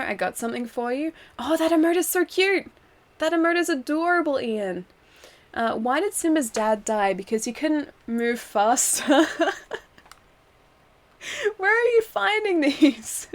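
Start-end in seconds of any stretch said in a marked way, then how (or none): 1.4-4.8 high-pitched cooing over something cute; sweetly smitten, pitched up; gushing. Real, not acted. 11.6-13.3 high, drawn-out incredulous question; pitched-up mock outrage at a terrible joke; amused disbelief. Real, not acted. Reacting to another bad joke.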